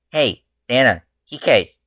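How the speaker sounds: calling to ghosts, teasing about naps. A narrator's reading. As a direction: call out across the rooftop.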